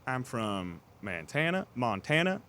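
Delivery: slow, drawled generic Southern; Southern accent. Pretend. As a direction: stretch the words like a rancher. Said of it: "I can do the generic Southern accent".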